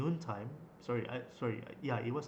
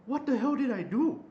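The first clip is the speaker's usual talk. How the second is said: retold alarmed protest; 11 semitones higher, 7 dB louder than usual. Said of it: "I was like".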